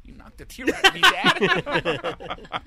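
high, loud mock kid wail; hammed-up whiny child voice; indignant tearful child. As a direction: blurt it like a wronged little boy. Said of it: "Did you cry?".

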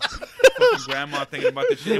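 cracking up as he quotes his friend; laughing. Retold or imitated. Laughing while quoting a friend's ghost story. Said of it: "Well, yeah, I heard of my".

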